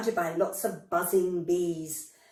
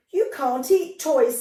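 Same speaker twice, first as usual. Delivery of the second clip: cross; 4 semitones higher, 5 dB louder than usual. A narrator's reading. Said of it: "glaring at Mister Bear".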